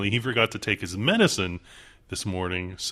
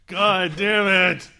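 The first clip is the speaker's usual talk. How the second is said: high, loud, drawn-out curse; exasperated outburst; 9 semitones higher, 5 dB louder than usual. Real, not acted. Co-host fed up with the accent bit, loudly exasperated. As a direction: cry out in exasperation.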